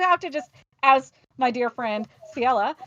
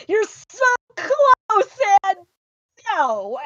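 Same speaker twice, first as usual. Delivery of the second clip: anguished groan; 11 semitones higher, 10 dB louder, much slower than usual. Retold or imitated. Disappointment near the win.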